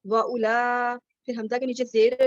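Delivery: ordinary talk, nothing marked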